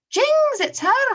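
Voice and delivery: very high, loud, drawn-out alarm; startled exclamation. Acted. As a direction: squeal it in comic Scottish dismay. Boy recognises teacher in acted strip.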